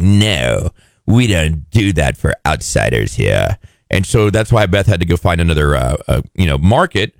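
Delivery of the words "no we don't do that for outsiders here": low, slow put-down, lightly acted; mock snooty refusal. Beulah turning Beth away from the butcher, mock snooty refusal. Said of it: "And she's like".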